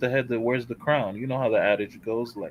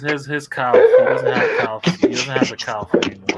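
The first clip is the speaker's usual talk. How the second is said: talking through laughter, louder; laughing; 7 dB louder than usual. Real, not acted. Friend joking about a player's missing calf, laughing. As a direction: crack up while getting the words out.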